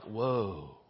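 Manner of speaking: very low, very soft, awed; stunned hush. Acted. Kids reacting to a parent's sudden yell, stunned and hushed. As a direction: shrink back with a barely voiced reaction.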